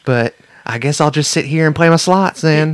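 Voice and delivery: hammed-up Southern local; Southern accent. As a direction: play a Vegas local with a Southern twang. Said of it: "I don't know why I sound southern".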